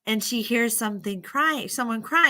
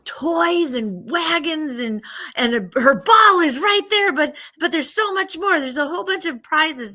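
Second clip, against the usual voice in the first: excited reveal; 6 semitones higher, 5 dB louder than usual. A narrator's reading. Storyteller reveals the girl's roomful of prizes, excited. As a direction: build to a loud, thrilled discovery.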